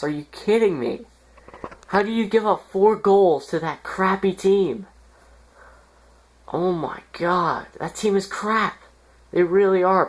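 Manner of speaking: high, strained disbelief; venting at the team's loss; exasperated. Real, not acted. Frustrated rant over a hockey loss.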